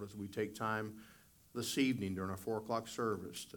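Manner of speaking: soft, reverent prayer before the sermon; opening prayer. Real, not acted. Pastor praying over the congregation, soft and reverent. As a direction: pray it quietly, head bowed.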